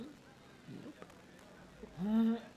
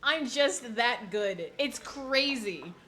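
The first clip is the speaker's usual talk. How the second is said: mock smug; 7 semitones higher, 5 dB louder, slower than usual. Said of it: "I'm that good at method acting".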